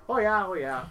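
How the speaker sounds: mock Canadian "Oh, yeah, oh, yeah" with a sing-song lilt. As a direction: tease the Canadian habit of ending with "Oh, yeah". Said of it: "they end every sentence with a".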